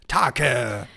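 deep, low put-on call; disguised deep voice. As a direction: bark the name in a low disguised voice. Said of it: "Ich hab sogar meine Stimme verstellt".

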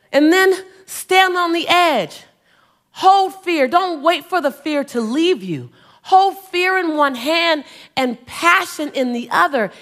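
rally the crowd to leap; motivational preaching. Acted.

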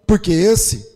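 high, loud, half-sung preaching over the music; preaching at full force. Acted. Pastor to the congregation, fervent sung-out preaching.

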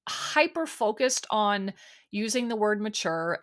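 ordinary delivery

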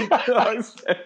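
laughing hard while repeating it; helpless real laughter. Real, not acted. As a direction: wheeze the words out through laughter. Laughing at the punchline.

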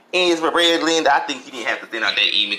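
usual speaking voice

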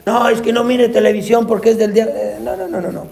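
lightly hammed-up church scold; mock strict churchgoer. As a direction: poke fun at a legalistic believer forbidding TV. Mocking a strict churchgoer's warning.

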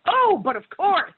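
a pompous, eager "of course"; mock posh agreement. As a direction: ham up a fawning network exec. Network exec agreeing grandly.

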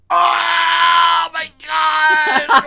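loud, high wailing moan; overplayed sick husband groaning; dramatic sick man. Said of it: "Willie gets sick".

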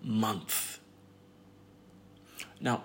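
normal talking voice

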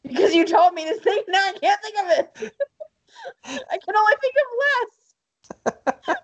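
laughing, high and helpless. Real, not acted. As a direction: crack up while protesting. Laughing frustration, cry heard.

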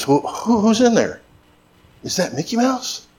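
high, soft, coaxing doctor voice; playful doctor. Retold or imitated. Doctor examining a nervous little girl, playful and high. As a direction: coax a child, high and soft. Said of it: "trying to put her at ease".

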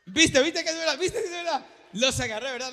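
loud, high, laughing "caught you"; teasing triumphant laugh; laughing gotcha. Real, not acted. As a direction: crow at the crowd with a laugh. Preacher gloats at congregation.

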